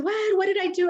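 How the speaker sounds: high, startled question from the foster mother; alarmed surprise. Retold or imitated. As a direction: jump up in alarm, as if caught out. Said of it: "She said".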